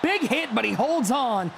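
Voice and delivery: rising call on the big hit; excited play-by-play. Real, not acted. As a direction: call the catch with a jump in energy. Live play-by-play, big catch.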